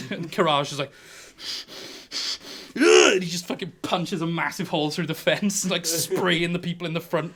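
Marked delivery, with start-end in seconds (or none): 2.8-3.1 high, loud grunt of rage; angry roar. Acted. Beaten fighter punching the fence, angry roar. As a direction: let out a frustrated battle roar.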